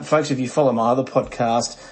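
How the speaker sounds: usual speaking voice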